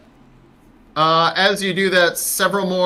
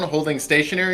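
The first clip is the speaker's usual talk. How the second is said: ordinary delivery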